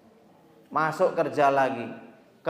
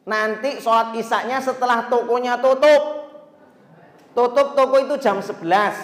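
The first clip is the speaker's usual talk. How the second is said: higher and louder boss voice; boss ordering; 7 semitones higher, 6 dB louder than usual. Retold or imitated. Boss ruling that prayer waits till closing, higher and louder. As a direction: settle it like a boss.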